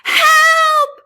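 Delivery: high, loud scream for help; panicked scream. A narrator's reading. Reef creatures screaming as the whale plunges in, panicked. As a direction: cry out in terror. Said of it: "they all screamed".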